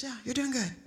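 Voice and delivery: imitated whisper from the passenger seat; hushed reassurance. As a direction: whisper it to steady a friend. Friend's hushed reassurance retold.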